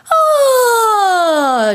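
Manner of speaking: high gliding sigh falling down; yawning sigh glide. Shown as a demonstration. Coach demonstrating a sighing glide warm-up. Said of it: "where you just go".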